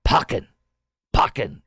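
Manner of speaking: sharp loud German command Packen; shouted dog command. Retold or imitated. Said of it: "And the command".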